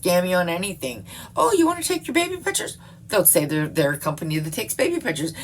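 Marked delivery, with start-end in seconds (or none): 1.3-2.8 high, quick put-on sales voice; mock scammer pitch. Mimics a scammer's sales offer.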